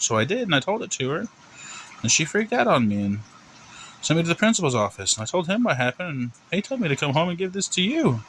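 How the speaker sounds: put-on high, sing-song kid's explanation; innocent little boy. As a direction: recount it as an innocent boy to the principal. Plays Timmy explaining to mom.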